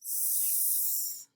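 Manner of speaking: S as a long, soft hissing sss; isolated final sound. Shown as a demonstration. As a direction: hold the quiet hiss of S, not the letter name. Final-sound drill, isolated phoneme.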